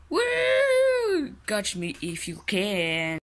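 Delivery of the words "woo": excited whoop. Acted. Excited cry over snack.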